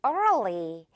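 early, stretched slowly over three beats. Shown as a demonstration. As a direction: drag out early with the tongue curling. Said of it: "그리고 early". (early를 천천히 늘여서)

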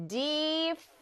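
"difficult" drawn out as dif, fi, cult; syllable by syllable. Shown as a demonstration. Teacher demonstrating three syllables, "difficult" syllable by syllable. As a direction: stretch each of the three syllables of "difficult" separately. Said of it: "해가지고 삼음절 이상".

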